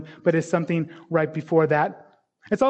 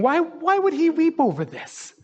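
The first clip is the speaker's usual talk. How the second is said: puzzled question; 12 semitones higher than usual. Retold or imitated. Quoting a puzzled question in class.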